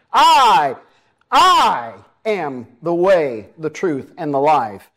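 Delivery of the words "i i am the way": emphatic doubled "I" before "am the way"; stress on I. Shown as a demonstration. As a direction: hit "I," and draw out "I am the way". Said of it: "the emphasis is on the I".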